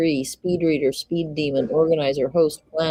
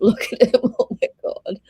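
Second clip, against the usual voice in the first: laughing; 5 semitones higher than usual. Real, not acted. Laughing at crying man on video.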